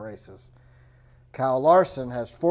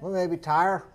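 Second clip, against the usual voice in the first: ordinary delivery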